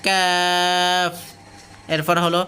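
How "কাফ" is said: কাফ drawn out on a level tone; stretched vowel. Shown as a demonstration. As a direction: hold the "aa" of কাফ very long. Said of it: "এগুলো টানেন".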